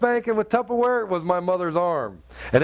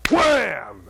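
mock hammer wham; 7 semitones higher, 3 dB louder than usual. Hammer strike sound effect.

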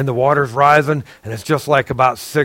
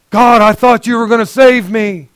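higher, louder complaint to God; man in heaven reproaching God, higher and louder; aggrieved complaint; 7 semitones higher, 4 dB louder than usual. Acted. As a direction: whine it like you were let down.

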